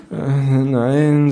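drawn-out, feeble hesitation; weakly. A narrator's reading. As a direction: answer as if about to pass out. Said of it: "sag ich noch schwach".

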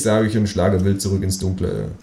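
usual speaking voice

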